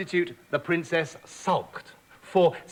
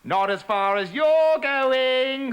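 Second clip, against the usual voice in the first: nasty taunt; 7 semitones higher, 4 dB louder, much slower than usual. Acted. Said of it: "said Petulant with a nasty laugh".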